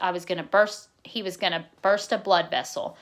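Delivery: ordinary delivery, nothing marked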